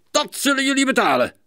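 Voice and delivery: high, fast, irate outburst; angry shout. Acted. Neighbour to the boys at the window, angry shout. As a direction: shout it crossly at the culprits. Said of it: "riep hij nijdig".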